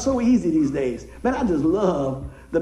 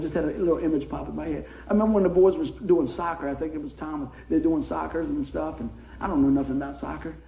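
usual speaking voice

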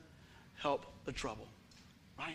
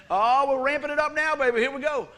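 hype; 8 semitones higher, 8 dB louder than usual. Real, not acted. Hyping up the congregation.

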